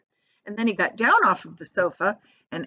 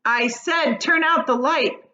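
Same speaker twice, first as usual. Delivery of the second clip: high, louder angry order; angry shout; 6 semitones higher, 4 dB louder, slower than usual. Acted. Centipede shouting angrily at James to turn out the light. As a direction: snap it out, fed up. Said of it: "shouted the centipede angrily".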